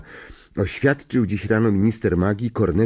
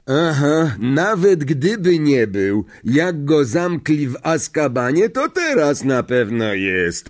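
slow, higher-pitched character reply; 7 semitones higher, 4 dB louder than usual. A narrator's reading. Driver agrees with Stan about the madman, slowly. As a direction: draw the words out deliberately. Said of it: "powiedział powoli Ernie".

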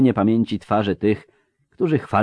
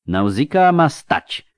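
loud, slow, raised order; sharp command; 9 semitones higher, 7 dB louder, slower than usual. A narrator's reading. Marshal refusing to send the brigade, sharp command. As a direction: lay down the order firmly. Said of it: "powiedział ostromenno".

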